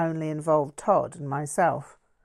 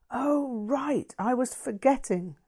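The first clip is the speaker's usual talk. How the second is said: higher, startled gasp of realisation; 7 semitones higher than usual. Acted. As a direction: catch your breath as it dawns on you. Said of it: "Linda gasped".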